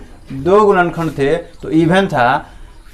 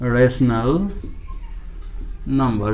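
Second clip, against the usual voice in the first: slow dictation; 3 semitones lower, 6 dB quieter, much slower than usual. Shown as a demonstration. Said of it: "rational number का हिंदी क्या होता है?".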